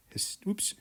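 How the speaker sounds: fumbled word. Real, not acted. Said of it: "Let's try that again".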